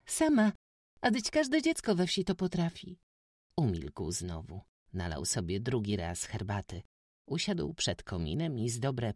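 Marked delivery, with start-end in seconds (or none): 0.1-3.0 put-on high, loud young girl explaining; proud village girl setting him right; eager peasant girl. A narrator's reading. Józka correcting the stranger, high girlish pride.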